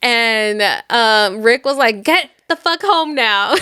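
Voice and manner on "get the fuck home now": mock urgent order. Acted order on phone.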